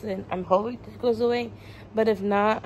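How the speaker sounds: ordinary delivery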